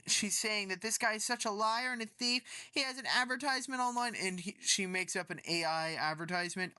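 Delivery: ordinary talk, nothing marked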